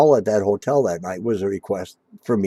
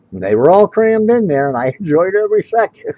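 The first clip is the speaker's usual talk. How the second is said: higher and louder, breaking into a laugh; laughing brag; 10 semitones higher, 8 dB louder than usual. Real, not acted. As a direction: gloat through real laughter. Gleeful brag about crammed van.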